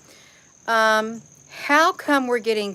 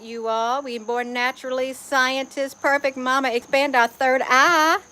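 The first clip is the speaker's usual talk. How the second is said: ordinary talk, nothing marked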